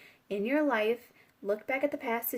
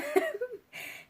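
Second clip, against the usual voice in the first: bright high burst of laughter; high giggle. Real, not acted. Reader cracking up at the cards, laughing. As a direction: giggle at the image.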